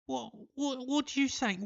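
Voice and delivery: very high, put-on English voice, baffled; mock English accent. English locals baffled by his word, in a high mock English accent. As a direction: ham up a confused English local. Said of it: "they were like".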